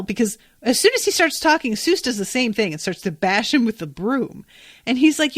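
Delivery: ordinary delivery, nothing marked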